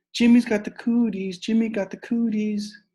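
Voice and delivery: high, loud teasing chant; playground taunt. Acted. Sheila chasing Jimmy, loud high chant. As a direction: sing it out to tease.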